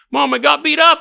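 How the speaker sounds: put-on high, loud kid's complaint to mom; whiny kid. Kid complaining to his mom, high and loud. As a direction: play the beaten kid crying out to mom.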